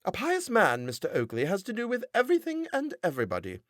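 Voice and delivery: high, preachy wife's voice; a pious wife defending the parson to her husband; sanctimonious wife. A narrator's reading. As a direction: lecture him like a pious scold. Missus Oakley voiced high, sanctimonious.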